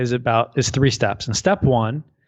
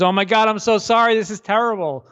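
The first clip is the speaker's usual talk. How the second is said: higher, fussing neighbor voice; a lightly voiced worried neighbor; mock sympathetic neighbor; 6 semitones higher than usual. Neighbor voiced a third time.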